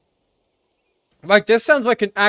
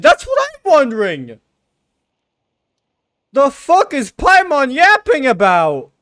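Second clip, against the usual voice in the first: loud, higher, slowed outraged complaint; a streamer yelling at the plot; exasperated rant; 8 semitones higher, 8 dB louder, slower than usual. Real, not acted. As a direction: vent at the game's nonsense story. Ranting at the confusing story.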